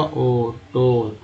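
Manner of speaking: टोन with a long, slow, low ओ sound; vowel demo. Shown as a demonstration. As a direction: draw out ओ in टोन slowly. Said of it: "वो का उच्चारण क्या हुआ?". (टोन में ओ को लंबा खींचना)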